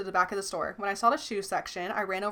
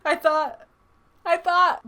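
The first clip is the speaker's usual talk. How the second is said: sobbing; 9 semitones higher, 8 dB louder, slower than usual. A narrator's reading. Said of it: "my sobs were heavier".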